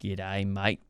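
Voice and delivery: soft, low Australian greeting; Australian accent. Shown as a demonstration. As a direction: quietly try an Australian accent. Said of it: "with a Australian accent".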